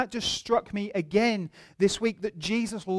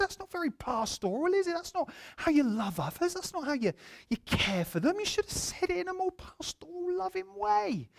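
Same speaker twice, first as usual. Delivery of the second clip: high-pitched, softer hammed-up scolding; mock indignant reproach; 11 semitones higher, 6 dB quieter than usual. Pastor telling his assistant to be more pastoral, mock-wounded. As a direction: chide him in a squeaky, wounded pitch.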